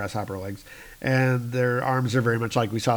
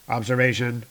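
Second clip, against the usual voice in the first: ordinary talk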